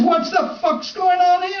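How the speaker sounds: high, outraged challenge; mock stern demand. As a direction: bark at the brawlers. Kid breaks up the fight.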